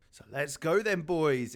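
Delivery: loud pep talk to the team; rallying shout. Acted. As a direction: fire up the boys.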